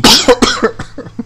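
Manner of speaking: hard rasping cough; sudden real coughing fit. Real, not acted. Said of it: "Só desculpa as tosses".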